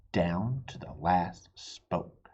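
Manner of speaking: plain reading voice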